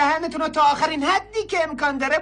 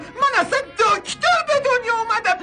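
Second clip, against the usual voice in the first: higher, sobbing protest under blows; crying out; 5 semitones higher than usual. Acted. Peasant beaten until he claims to be a doctor, crying. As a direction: wail the confession while being beaten.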